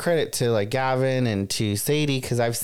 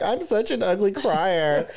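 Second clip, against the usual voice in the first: higher, slower self-mocking whine; 7 semitones higher, slower than usual. Retelling her talk with a friend, a higher, slower self-mocking whine. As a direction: drag it out, laughing at yourself. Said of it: "And I was like".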